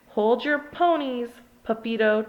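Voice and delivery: usual speaking voice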